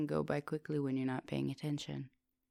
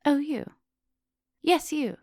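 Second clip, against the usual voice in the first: high, loud call across the classroom; 10 semitones higher, 9 dB louder than usual. Acted. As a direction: call out to catch a student's eye. Teacher calls student across classroom.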